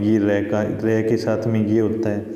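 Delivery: normal talking voice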